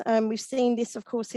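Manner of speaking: usual speaking voice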